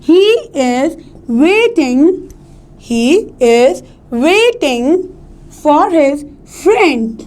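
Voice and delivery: "waiting" and "friend" stretched very slowly, word by word; exaggerated tonic stress. Shown as a demonstration. Teacher slowing the example to show stress, "waiting" and "friend" drawn out. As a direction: draw out "waiting" and "friend" with rise and fall. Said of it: "जिस पे हम stress दे रहे हैं".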